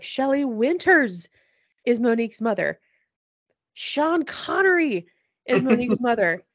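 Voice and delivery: slow, loud, high tipsy pronouncement; drunk declaration. Retold or imitated. Podcaster imitates drunk Elise telling the bartender, slow and loud. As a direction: announce it slowly like a drunk sage.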